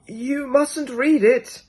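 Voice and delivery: put-on high, slow, weary child-elder; a slow, high warning from an ageless child; ancient child. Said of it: "said the ancient child".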